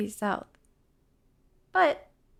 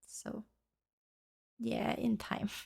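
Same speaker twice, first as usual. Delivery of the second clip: whispered aside; 10 dB quieter, slower than usual. Real, not acted. Aside to partner about lazy dog.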